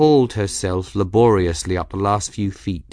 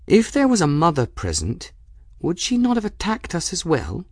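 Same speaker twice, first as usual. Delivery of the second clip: put-on higher-pitched girl, reasoning aloud; lightly voiced questioning girl; 9 semitones higher than usual. Bridget questioning Folly about the mother, reasoning.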